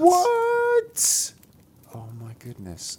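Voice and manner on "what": very high astonished squeal; shocked. Real, not acted. Echoing shocked reaction.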